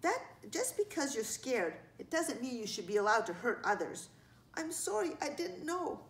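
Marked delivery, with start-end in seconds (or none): 4.5-6.0 high, small voice; apologetic child. A narrator's reading. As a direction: say sorry meekly as a child.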